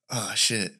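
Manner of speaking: normal talking voice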